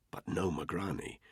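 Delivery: ordinary delivery, nothing marked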